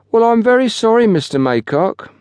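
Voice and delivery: high-pitched, resentful non-apology; insincere apology. A narrator's reading. Porter apologises to his boss, resentfully insincere. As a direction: apologise so that it cancels itself out. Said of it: "a tone of voice which effectively cancelled the apology".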